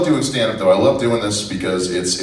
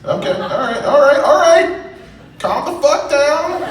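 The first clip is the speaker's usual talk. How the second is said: very high squeal, rising louder; mock panic; 4 dB louder, slower than usual. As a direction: shriek it in mock alarm at the crowd. Mock alarm at noisy crowd's answers.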